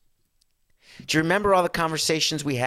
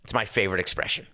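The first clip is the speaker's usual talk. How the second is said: usual speaking voice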